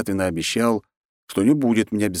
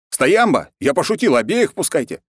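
higher, louder hurried yell; shouting; 10 semitones higher, 5 dB louder than usual. Acted. Hero yells to Potapov to let both guests in, shouting. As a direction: take the joke back at full volume.

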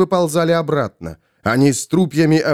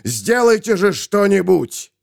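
urgent high-pitched exclamation; moderately raised cry; 6 semitones higher than usual. A narrator's reading. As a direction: demand action in a raised pitch. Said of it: "закричал Нейл".

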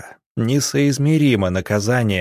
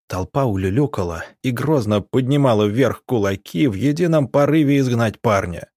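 plain reading voice

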